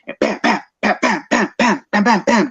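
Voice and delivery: mimicking fast jabs, quick punchy bams; punch sounds. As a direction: snap out each punch like a jab landing. Imitating rapid jabs.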